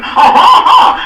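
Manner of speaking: high, loud braying ha ha ha; haughty lord's braying laugh; mocking laugh. A narrator's reading. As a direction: bray it out in scorn.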